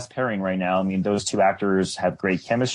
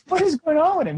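much higher, startled disbelief; baffled; 3 dB louder than usual. Retold or imitated. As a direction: squeak it out like you can't believe it. Retelling her own baffled reaction.

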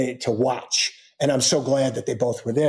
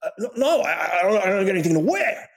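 much higher, fast, laughing imitation of a flustered father; flustered dad; 7 semitones higher, faster than usual. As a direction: play the dad caught off guard, laughing nervously. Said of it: "And he goes".

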